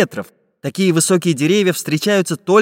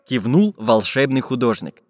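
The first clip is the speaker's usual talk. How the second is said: ordinary delivery, nothing marked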